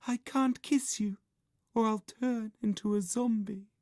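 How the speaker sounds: very high, airy film heroine; sulky girl. A narrator's reading. Pale girl refusing bandaged boy, sulky. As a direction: sulk in a thin girlish voice. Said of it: "sulked the girl".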